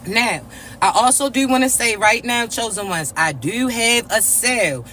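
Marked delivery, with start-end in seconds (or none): none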